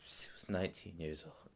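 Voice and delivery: numb, held-in statement; subdued grief. Acted. Father stating his dead daughter's age, subdued. First take before the outburst.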